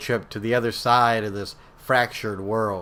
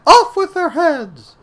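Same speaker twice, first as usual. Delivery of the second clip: high screeching royal command; Queen of Hearts shout; 10 dB louder than usual. A narrator's reading. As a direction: shriek it like a furious queen. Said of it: "As the Queen of Hearts repeated".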